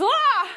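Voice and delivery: high startled yelp of panic; a short high storybook scream; panicked scream. Acted. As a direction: cry out in sudden fright. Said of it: "he starts to panic and he screams".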